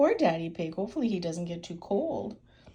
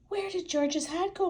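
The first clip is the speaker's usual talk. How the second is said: puzzled mother voice; 11 semitones higher than usual. Acted. Mummy Pig asks.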